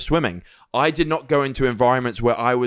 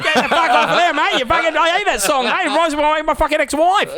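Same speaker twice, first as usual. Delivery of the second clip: high, hammed-up Aussie bloke shouting off the stage; mock Australian yell; 11 semitones higher than usual. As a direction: play a drunk Australian heckler, way up in pitch. Imitates angry Aussie at karaoke.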